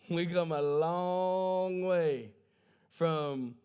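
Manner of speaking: drawn-out, slow repeat of the line. Shown as a demonstration. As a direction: stretch every word out slowly.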